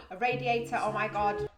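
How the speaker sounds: loud, delighted outburst; really excited. Real, not acted. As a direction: exclaim it like a thrilling discovery. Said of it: "I got really excited with the radiators".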